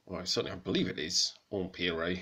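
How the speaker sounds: ordinary talk, nothing marked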